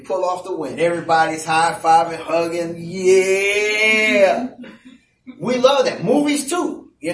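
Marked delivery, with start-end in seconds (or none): 2.8-4.5 long drawn-out team cheer; celebrating cheer. Acted. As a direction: stretch it out like a winning crowd. Acts out a team celebrating a win.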